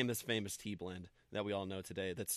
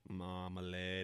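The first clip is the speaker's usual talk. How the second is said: mock English accent; 4 semitones lower, 6 dB quieter, slower than usual. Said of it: "has to be said in an English accent".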